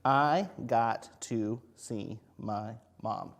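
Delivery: very slow, word by word; slow read-back. Shown as a demonstration. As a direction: read it back slowly for children. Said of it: "So I wrote".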